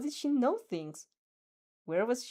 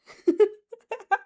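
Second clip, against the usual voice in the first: high loud burst of laughter; 12 semitones higher, 11 dB louder than usual. Real, not acted. Streamer bursts out laughing at the chat. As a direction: crack up.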